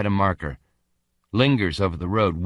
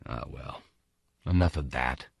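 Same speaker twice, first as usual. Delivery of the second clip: resigned murmur; 8 semitones lower, 8 dB quieter than usual. Acted. Stalker dismisses his craving.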